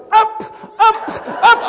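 will the plane off the ground; urging up. Retold or imitated. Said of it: "the pilot's going".

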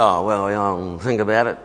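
imitating a son who isn't keen; reluctant son. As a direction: brush off the invitation, voice dropped low. Son's reluctant reply retold.

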